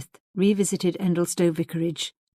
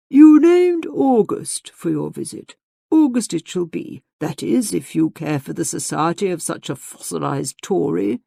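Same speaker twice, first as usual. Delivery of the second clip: hearty host; 6 dB louder than usual. A narrator's reading. Hearty old father inviting guest back.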